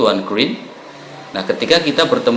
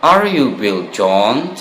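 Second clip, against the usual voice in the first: Are you Bill John said slowly, rising at the end like a real question; slow teacher-modelled question with rising intonation; questioning tune; slower than usual. Shown as a demonstration. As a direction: ask it slowly with a clear upward lilt. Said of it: "seperti bertanya".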